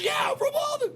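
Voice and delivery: a high, excited, shouted yeah; half-yelling with thrilled surprise. Retold or imitated. Retelling two Malden guys meeting, high excited shout.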